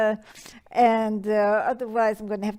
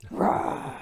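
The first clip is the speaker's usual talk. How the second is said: soft roar. Acted. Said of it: "visualize yourself as a lion".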